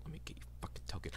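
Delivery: fast, whispered side comment; a rapid whispered aside to a player; quick aside whisper. Real, not acted. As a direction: mutter it fast under your breath. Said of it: "player aside while GMing".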